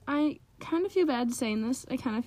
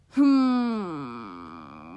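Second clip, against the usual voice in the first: puzzled hum; 12 semitones lower than usual. Retold or imitated. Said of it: "and I'm like".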